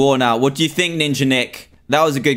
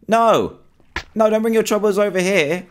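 higher, urgent plea; panicked pleading; 7 semitones higher than usual. Real, not acted. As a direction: beg the seeker to go away. Seeker heading towards his spot.

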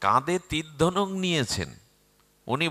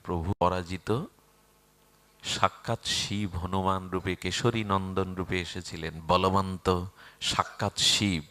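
low, soft devotional tone; hushed reverence; 8 semitones lower, 6 dB quieter than usual. Acted. Hanuman remembers his lord's feet, hushed reverence. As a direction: speak humbly, in awe of the divine.

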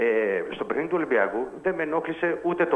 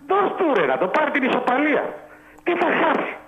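much higher, pressing the point; worked-up pleading; 9 semitones higher than usual. Real, not acted. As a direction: urge it, exasperated. Caller arguing team should settle draw.

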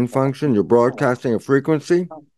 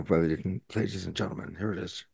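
hushed; 3 semitones lower, 13 dB quieter, faster than usual. Real, not acted. Hushed aside before the quote.

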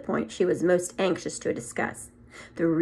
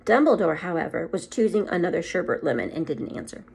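ordinary delivery, nothing marked

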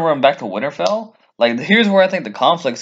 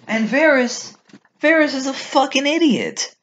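exasperated rant; 11 semitones higher than usual. Real, not acted. Fan ranting at Varys's stupidity, high exasperated voice. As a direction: voice shoots up high in disbelief.

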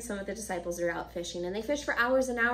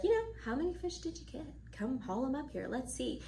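casual, soft invitation; 4 semitones higher, 6 dB quieter than usual. Acted. Jesus casually calls to disciples.

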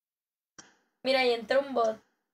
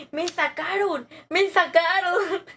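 higher, drawn-out outraged cry; indignant surprise; 4 semitones higher, 4 dB louder, slower than usual. Real, not acted. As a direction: exclaim in disbelief at being kicked out. Just got kicked from the team. (exclamando indignada)

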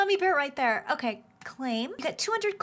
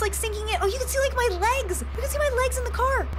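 ordinary talk, nothing marked

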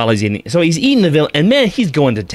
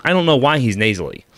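ordinary delivery

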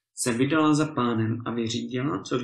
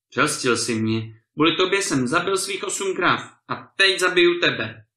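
loud, furious threat; angry threat; 5 semitones higher, 6 dB louder than usual. A narrator's reading. Ruined lord threatens to kill the tenant, loud and furious. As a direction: hurl the threat at him.

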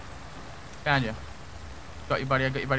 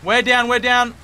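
shouting; 6 semitones higher, 8 dB louder, slower than usual. Real, not acted. Calling out in firefight.

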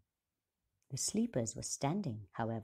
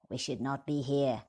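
grumbling dwarf; 2 semitones lower, 5 dB louder than usual. A narrator's reading. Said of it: "grumbled the dwarf with the angry brown beard".

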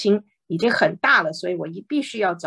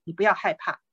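normal talking voice

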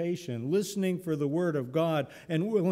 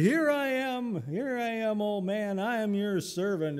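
put-on eager young Samuel; 7 semitones higher than usual. Boy Samuel answering Eli.

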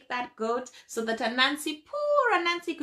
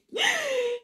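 gasp of surprise; 13 semitones higher than usual. A narrator's reading. Anansi surprised by ox.

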